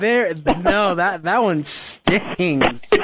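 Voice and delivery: slow, drawn-out mock grievance; mock stung. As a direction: drag out the sting, playfully hurt. Playing hurt after IQ jab.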